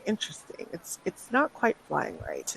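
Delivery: whispered to himself. Acted. The doctor peering at the wasps, whispering to himself. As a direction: murmur it privately, as if thinking aloud. Doctor studies the wasp jar.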